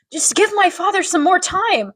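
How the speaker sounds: a hint of a pleading daughter; retold pleading daughter. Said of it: "Daughter says".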